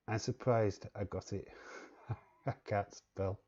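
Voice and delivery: normal talking voice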